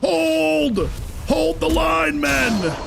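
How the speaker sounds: high, shouted commander's call; gruff barked parade orders. Acted. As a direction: bark orders to troops on the wall. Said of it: "I'm talking like I have friends".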